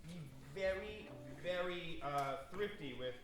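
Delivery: slow, drawn out advice; slow and thrifty. Shown as a demonstration. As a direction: stretch it out, be thrifty. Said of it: "Just stay compact".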